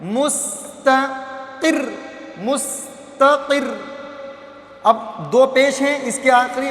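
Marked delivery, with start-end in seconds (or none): 0.0-3.6 slow recitation demo. Shown as a demonstration. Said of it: "तजदीद वाला word है रा".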